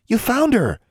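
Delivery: very high, astonished outburst; gasped surprise. A narrator's reading. Roommate sees the lost creature, gasping surprise. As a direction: exclaim it high, amazed. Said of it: "Anna gasped".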